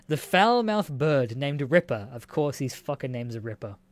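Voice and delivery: usual speaking voice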